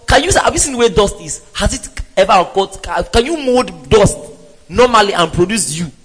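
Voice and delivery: usual speaking voice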